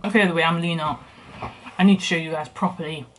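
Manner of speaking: speaking around a bite; talking while chewing. Real, not acted. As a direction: mouth partly full of food. Seen 0: Potato forked into mouth at -2; lips move closed-ish while talking.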